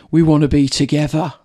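lightly imitated Birmingham accent; a touch of broad Birmingham; Mark Williams. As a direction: quote the line in mock Brummie. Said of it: "this really broad Birmingham accent".

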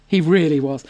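ordinary talk, nothing marked

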